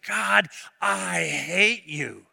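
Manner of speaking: slow, drawn-out mock outburst at God; retold angry cry. Preacher retelling a broken pastor cursing God, slow mock anger. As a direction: stretch out a bitter cry. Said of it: "that he screamed out".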